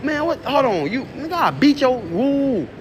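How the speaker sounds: retold angry outburst after taking the mask off; Chicago coming out. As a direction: let the temper snap out. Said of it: "The Chicago coming out".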